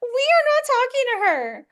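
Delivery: put-on very high childlike voice, pleading; frightened inner child. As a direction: insist like a scared little girl. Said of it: "I could hear the voice in my head going".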